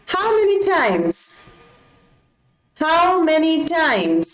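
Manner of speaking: high, slow drill of "how many times". Shown as a demonstration. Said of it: "teacher drilling slowly". (ऊँची, धीमी आवाज़ में अभ्यास)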